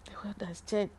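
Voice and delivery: whispered in wonder; awed whisper. Real, not acted. Recalling the miracle, an awed whisper. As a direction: breathe it out in awe. Awe after miracle news.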